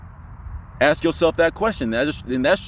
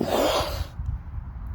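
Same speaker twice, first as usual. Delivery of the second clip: slow airy exhale; long air release; 7 dB quieter than usual. Shown as a demonstration. Coach imitating a balloon letting go. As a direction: let it all rush out. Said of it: "let the little neck thing go".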